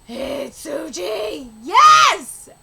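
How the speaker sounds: triumphant yell; jubilant shout. Acted. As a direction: cheer as the ally finally breaks through. Characters cheer an arrival.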